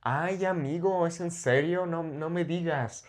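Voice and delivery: soft, lilting Mexico City fresa accent; playful fresa-accent impression. Shown as a demonstration. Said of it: "it's kind of the fresa accent".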